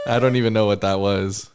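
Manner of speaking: laughing till near tears. Real, not acted. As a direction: crack up while talking. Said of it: "What the fuck was that?".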